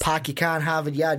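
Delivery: a lightly put-on Boston accent; bad Boston accent. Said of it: "That's my bad Boston accent".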